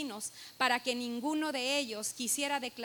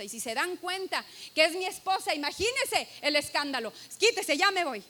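panicked hurry; 3 semitones higher, 5 dB louder than usual. Retold or imitated. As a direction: hiss it out then louder, desperate to leave. Man panicking to leave.

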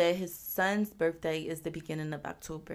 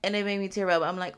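ordinary talk

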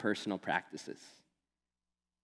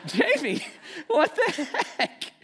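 much higher, laughing what the heck; laughing disbelief; 3 dB louder than usual. Retold or imitated. Pastor retelling his shock at Jamie, laughing. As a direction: crack up in disbelief. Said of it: "I'm like".

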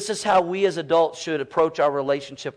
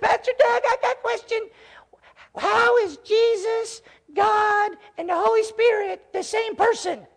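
put-on much higher little boy voice; cute little gruff voice. Pastor imitating a small boy's much higher voice as he runs up with a question. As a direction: play a four-year-old eager to ask his pastor. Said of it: "this cutest little gruff voice".

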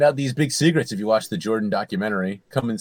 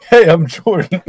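loud, higher attempt at Irish; badly faked Irish accent, very loud; mock Irish accent; 7 semitones higher, 11 dB louder than usual. Shown as a demonstration. Jordan's acting reel introduction, mock Irish accent. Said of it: "this is an Irish accent".

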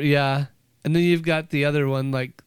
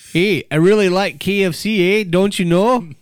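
put-on loud, higher-pitched Fargo accent; 3 semitones higher, 6 dB louder than usual. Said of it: "I'm up here in, in Fargo".

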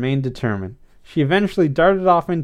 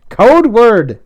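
loud, high, insistent order; forceful raised-voice command; demanding; 9 semitones higher, 10 dB louder than usual. A narrator's reading. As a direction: repeat it as a firm demand. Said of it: "Nick demanded".

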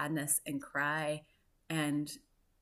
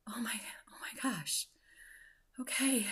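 breathy hushed gasp of shock, retold; stunned whispered oh my gosh; 6 semitones higher, 4 dB quieter than usual. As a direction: whisper it as if the floor dropped away. Hears husband is done.

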